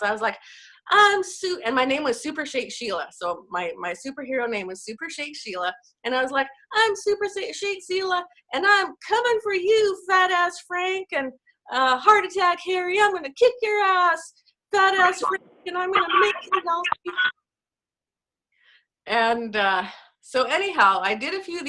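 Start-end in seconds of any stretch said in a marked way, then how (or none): 0.9-1.6 much higher, loud wrestler-style promo shout; hammed-up superhero bravado; superhero monologue. Mom playing a homemade superhero for her YouTube videos, loud high hammed-up promo. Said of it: "like, superheroes or wrestlers, ... these monologues". 6.7-16.8 much higher and louder, hammed-up trash-talking promo with a bad mock Brooklyn accent; superhero wrestler monologue. Super Shake Sheila taunting fat ass Frank in her video, loud high mock-Brooklyn trash talk. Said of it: "these monologues".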